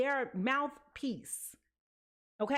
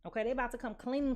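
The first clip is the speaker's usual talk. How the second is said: ordinary talk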